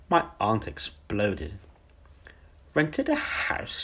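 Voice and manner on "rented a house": very high, slow, outraged shriek of disbelief; shouted. A narrator's reading. The aunt exploding at Miss Honey's news, high outraged shout. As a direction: fling the words back at her in shock. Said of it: "she shouted".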